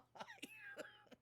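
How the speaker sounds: squealing high-pitched giggle; high embarrassed giggle. Real, not acted. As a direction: squeak out a laugh. Embarrassed by own writing.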